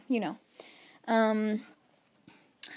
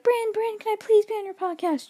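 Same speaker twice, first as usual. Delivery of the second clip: high pleading mock voice of her brother; 10 semitones higher than usual. Sister imitating brother begging to join her podcast. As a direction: whine it like a teasing little brother begging. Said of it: "He'll just, like, sit there and be like".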